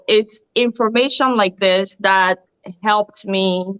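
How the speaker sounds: ordinary talk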